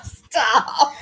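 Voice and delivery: normal talking voice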